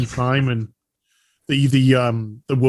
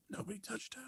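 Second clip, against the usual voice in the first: whisper; 8 semitones higher, 27 dB quieter, faster than usual. Pretend. Punchline about the virgin birth.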